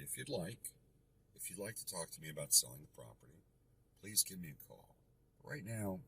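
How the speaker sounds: soft, hushed and even; very calm. Shown as a demonstration. Investor calmly ending a heated call, softly. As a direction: settle them down, gently and quietly. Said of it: "I will very calmly say".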